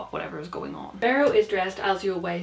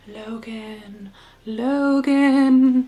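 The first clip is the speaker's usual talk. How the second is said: eerie drawn-out name call, swelling louder; ghostly wife; 3 semitones higher, 8 dB louder, much slower than usual. Acted. Shadowy dead wife calls to Logan, eerie and drawn out. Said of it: "He recognizes the voice as the voice of his wife".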